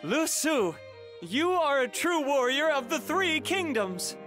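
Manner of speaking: high, ringing commendation; rousing praise. Acted. Commander to Lu Su after a feat, rousing praise. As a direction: hail your comrade as a great warrior.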